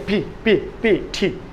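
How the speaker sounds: "পি" and "টি" each with a puff of air. Shown as a demonstration. Teacher showing air on the hand, "পি, টি" with puffs. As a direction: pop "পি, টি" out as separate aspirated letters. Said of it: "বাতাস দিবে".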